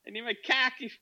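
high-pitched mock Boston accent; badly put-on Boston accent, breaking into a laugh; horrible Boston accent. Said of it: "What a horrible accent".

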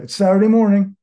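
indignant disbelief. Retold or imitated. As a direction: push back at the early visit in disbelief. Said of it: "And I was like".

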